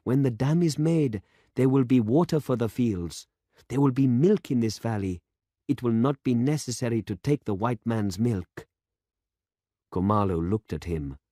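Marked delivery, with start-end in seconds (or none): 0.0-8.7 put-on young demonstrator. A narrator's reading. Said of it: "said the demonstrator".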